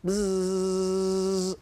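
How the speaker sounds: long, steady voiced buzz; imitated buzz. Shown as a demonstration. Teacher buzzing round the board in a letter game, steady buzz. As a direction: buzz like a bee on one note.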